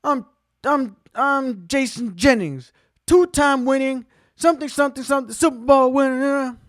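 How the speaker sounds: high, loud outraged boast; entitled football player yelling at the valet; arrogant athlete yelling. Retold or imitated. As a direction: bellow it like an entitled star. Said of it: "And he's like".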